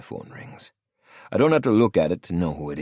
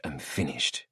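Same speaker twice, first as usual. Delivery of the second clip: ordinary delivery, nothing marked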